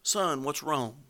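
slow, quiet tenderness. Retold or imitated. Father pleads with his sulking son, slow and tender. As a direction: coax him gently, drawing it out. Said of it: "not raising his voice".

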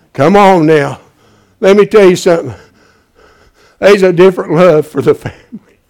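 loud, driving pulpit preaching; rising fervent sermon shout; preaching at full force. Acted. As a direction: hammer it home to the congregation.

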